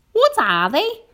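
high, loud anxious question; worried earthworm. A narrator's reading. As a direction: ask with rising worry in a high character voice. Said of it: "getting worried".

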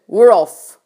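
loud, punchy announcement; triumphant shout. A narrator's reading. Centipede announces to everyone, loud triumphant shout.

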